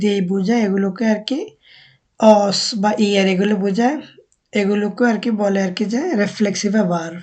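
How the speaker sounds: normal talking voice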